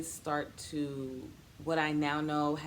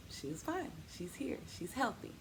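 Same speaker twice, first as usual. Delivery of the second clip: soft whisper; 3 semitones higher, 7 dB quieter than usual. Real, not acted. Relief over the healthy baby.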